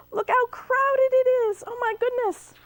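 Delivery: astonished at the crowded boat; big gasp, high excited; gasping surprise. Real, not acted. Narrator reacts to moose.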